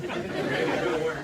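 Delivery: loud, slow, punched punchline. Acted. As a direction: belt it out slowly for the laugh. Punchline after grandma quote.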